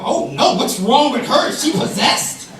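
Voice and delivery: high, loud pearl-clutching gasp; elderly churchgoer shocked at a crying child; scandalized old people. Retold or imitated. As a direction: play the horrified church elder. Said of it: "they wrinkle up their face and they go".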